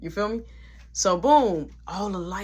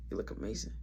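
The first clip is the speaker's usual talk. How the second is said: soft, lower whispered praise; 9 semitones lower, 12 dB quieter, faster than usual. Retold or imitated. Man complimenting Kiki in the bathroom, whispering. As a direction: breathe it out just for her.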